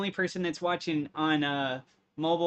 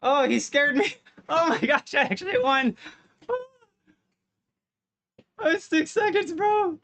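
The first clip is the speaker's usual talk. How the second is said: real squealing victory joy, much higher, louder; shrieking jackpot winner; 9 semitones higher, 6 dB louder than usual. Real, not acted. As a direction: ecstatic high shrieks of winning. Just won a close match.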